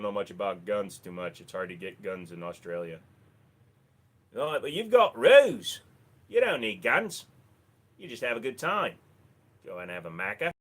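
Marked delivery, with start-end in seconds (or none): 4.3-10.5 put-on playful Australian accent, swooping then settling; Aussie accent. Streamer teasing a viewer from Australia, Aussie accent. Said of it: "I've lost the Aussie accent".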